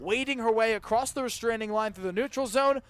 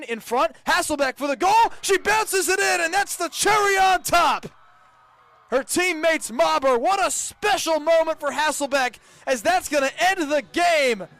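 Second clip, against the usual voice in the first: high, loud shouting call of the final goal; real jubilant shout, much higher and louder; excited goal call; 6 semitones higher, 6 dB louder than usual. Real, not acted. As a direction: let it rip as the clinching goal goes in. Final goal, game-ending call.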